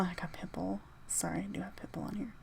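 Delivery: hushed, sheepish whisper, quick at the end. Real, not acted. Vlogger admits to his viewers that [name] is on his list, whispered. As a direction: whisper it like a guilty confession.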